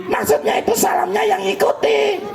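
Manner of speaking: high puppet voice parroting the line; puppet copying. Acted. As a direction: echo it back as the cheeky puppet. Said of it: "Kok jadi ngomongnya Kak Usman diikuti". (suara boneka tinggi menirukan)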